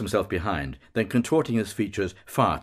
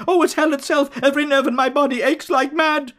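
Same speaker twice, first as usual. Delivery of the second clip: high, loud anguished scream; shrieking in agony, much higher and louder; 5 dB louder, faster than usual. A narrator's reading. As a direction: cry out in unbearable pain. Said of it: "Bloom screams".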